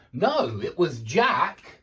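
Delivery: "Jack" punched loud; stress on Jack. Shown as a demonstration. Correcting someone on who dumped whom, stress on "Jack". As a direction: lean hard on "Jack" to correct them. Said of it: "you put emphasis on that part".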